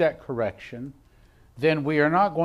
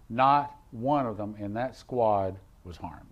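normal talking voice

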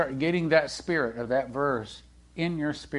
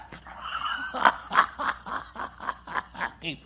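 laugh; 12 semitones higher, 5 dB quieter than usual. Real, not acted. Laughter after the shout.

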